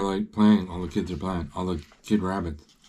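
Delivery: plain reading voice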